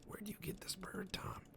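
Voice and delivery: hushed whisper; breathless whispered question; retold whisper.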